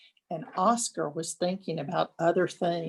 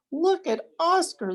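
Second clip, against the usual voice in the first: much higher, jeering call; mocking cry; 10 semitones higher than usual. A narrator's reading. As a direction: point and jeer at him. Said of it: "they cried".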